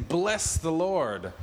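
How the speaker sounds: slow declaration. Retold or imitated. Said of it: "And he's saying".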